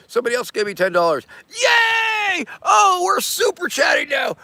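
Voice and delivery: high, whooping shouts of joy; excited shouting. Real, not acted. Streamer cheers as super chats pour in, high shouting. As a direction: cheer like riding a big wave.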